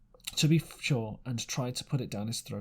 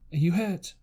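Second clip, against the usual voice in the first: cried out; 5 semitones higher, 5 dB louder than usual. A narrator's reading. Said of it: "cried I".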